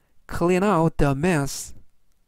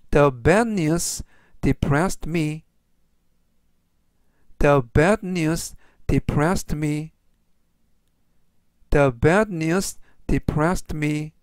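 slow, measured English for learners; teacher's deliberate, word-by-word pace; slow model reading; much slower than usual. Shown as a demonstration. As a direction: read it out slowly for repeat-after-me. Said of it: "천천히 세 번씩 읽어드리고요".

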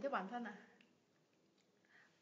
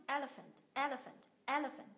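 "elephant" with the first syllable el stressed; 2 semitones higher than usual. Shown as a demonstration. Teacher answering a student's question, first-syllable stress. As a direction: punch the el in "elephant", let the rest fall away. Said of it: "nhấn vào tiết thứ nhất".

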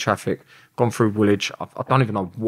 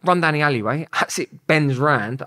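ordinary delivery

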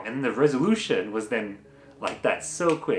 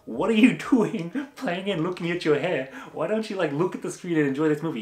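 playful indignant rant; 5 semitones higher than usual. Retold or imitated. Mock-scolding the girl in cinema.

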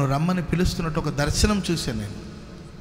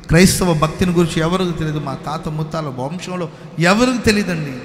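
full-force preaching; 2 semitones higher, 5 dB louder than usual. Acted. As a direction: preach it to the crowd. Preaching to the congregation.

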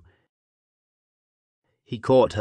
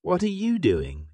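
high-pitched lion's question; 10 semitones higher than usual. A narrator's reading. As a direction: ask it with the pitch leaping up high. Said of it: "asked the lion".

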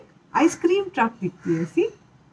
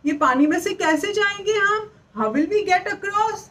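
put-on high, slow worried troll for children; lightly voiced storybook troll; 7 semitones higher, slower than usual. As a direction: stretch it out like a puzzled troll. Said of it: "वो troll बोलता है".

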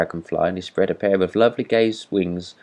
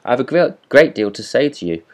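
raised, insistent calling; higher, louder plea for attention; screamed; 5 semitones higher, 5 dB louder than usual. A narrator's reading. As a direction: keep calling after a departing listener. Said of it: "screamed the Rocket".

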